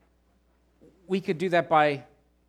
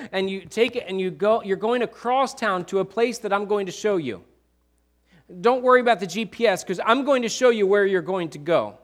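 louder, driving sermon voice; full-force preaching; 4 semitones higher, 5 dB louder than usual. Acted. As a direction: preach it out to the back row. Preaches God's call at full force.

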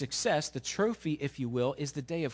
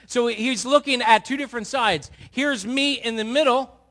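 full-force preaching; 7 semitones higher, 9 dB louder than usual. Acted. Pastor urging the congregation to live for God, preaching hard. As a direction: drive every word home to the pews.

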